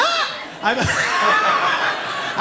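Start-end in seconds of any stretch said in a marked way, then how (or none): 0.0-0.4 very high startled yelp, acted out; comic high-pitched scare scream. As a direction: shriek briefly as if jumped on. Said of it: "when I get scared".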